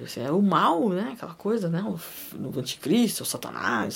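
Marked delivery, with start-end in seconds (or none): 3.2-4.0 low, hushed whisper naming the devil; a sinister low whisper; spooky whisper. Acted. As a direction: breathe it out like something evil.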